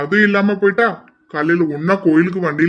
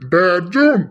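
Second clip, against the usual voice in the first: usual speaking voice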